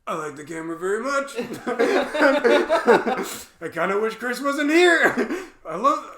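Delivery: high, loud laughing gush; emotional laughing gush. Retold or imitated. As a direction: fight back tears while laughing. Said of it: "And I'm like".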